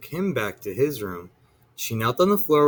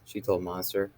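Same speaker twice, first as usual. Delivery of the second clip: plain reading voice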